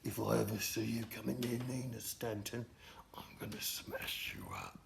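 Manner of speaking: hushed threatening whisper; Gary whispering a threat; menacing whisper. Retold or imitated. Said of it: "Gary's like".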